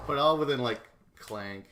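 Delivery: loud mock clank sound; playful chain-noise imitation; imitated clank. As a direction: bang out the clank.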